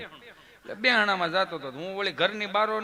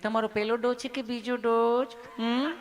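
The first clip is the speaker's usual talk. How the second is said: sweet, loving nurse; 2 semitones higher than usual. Acted. Nurse asking a patient about his dose, sweetly and lovingly. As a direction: ask lovingly, ending on a coaxing hum. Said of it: "તમે કેટલું પ્રેમથી પૂછો છો".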